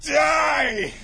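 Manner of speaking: high, loud acted shout; battle cry. Villains whipping out grimoires to attack, shouted battle cry. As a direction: yell it as you attack.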